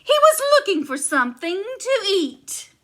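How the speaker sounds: giantess huffy. Acted. Giantess explains.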